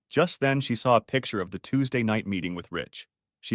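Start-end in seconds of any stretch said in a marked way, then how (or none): none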